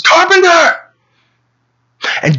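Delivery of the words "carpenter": very high, loud shout; furious scream. A narrator's reading. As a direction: yell for him at the top of your lungs. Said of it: "He screams".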